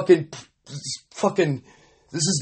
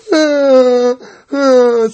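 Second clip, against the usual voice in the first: high, loud mocking ah; 9 semitones higher, 6 dB louder than usual. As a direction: jeer with a high ah. Mocking a sick burn.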